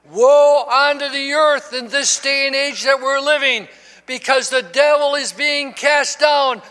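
sustained warning building to a shouted climax; impassioned preaching. Acted. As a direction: drive it home to the congregation, rising to a shout. Preaching at full force.

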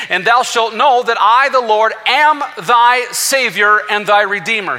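high, loud, slow scripture proclamation; full-force preaching. Acted. Preacher quoting Isaiah to the congregation, full-force preaching. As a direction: ring it out as God's own declaration.

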